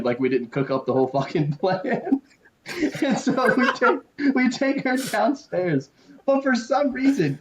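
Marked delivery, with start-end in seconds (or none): 3.0-7.4 laughing. Real, not acted. Still laughing, high and slow.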